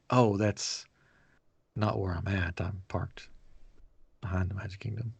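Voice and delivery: embarrassed confession, barely voiced; sheepish. New hire admitting to staff where he parked, sheepish whisper.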